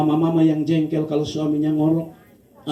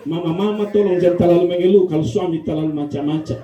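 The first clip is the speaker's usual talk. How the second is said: ordinary talk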